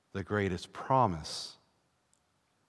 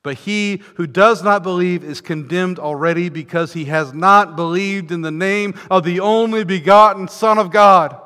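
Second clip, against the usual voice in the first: loud, high, pounding sermon voice; preacher warning the congregation, full force; fiery preaching; 8 semitones higher, 14 dB louder than usual. Acted. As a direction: drive it home from the pulpit.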